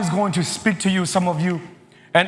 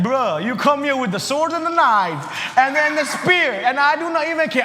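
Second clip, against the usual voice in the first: fearless David; 7 semitones higher than usual. Acted. David telling the giant he is not afraid, ending in a shout. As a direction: shrug it off, then climb to a ringing cry. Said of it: "David was like".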